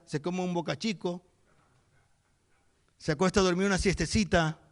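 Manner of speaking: ordinary delivery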